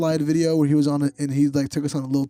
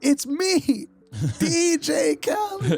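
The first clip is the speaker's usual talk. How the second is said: high, very slow [name]; 14 semitones higher, much slower than usual. Retold or imitated. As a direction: drag out the name up high. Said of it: "It's like".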